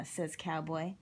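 plain reading voice

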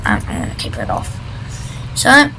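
ordinary delivery

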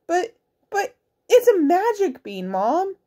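put-on high, pleading little boy. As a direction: beg like a young boy. Boy pleads with angry mom.

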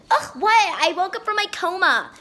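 loud mock outburst. Character loud return.

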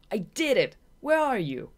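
loud, high, victorious calling out; triumphant call. Acted. Shade searching for Marina after winning, loud excited call. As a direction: shout for her, still buzzing with victory.

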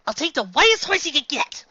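high, loud, eager demand; excited shout. Acted. Usagi shouting her order at the horse store. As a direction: shout it like a giddy shopper slamming down cash.

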